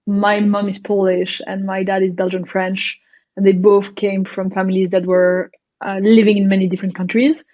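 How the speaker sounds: ordinary delivery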